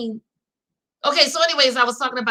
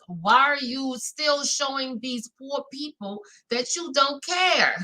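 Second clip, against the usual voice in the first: ordinary talk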